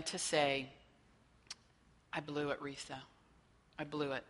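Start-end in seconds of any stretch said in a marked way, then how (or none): 2.1-4.2 softer, lightly voiced regretful kid; mild remorse. Counselor voicing a teen admitting a mistake, softly remorseful. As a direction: own up quietly, a touch ashamed.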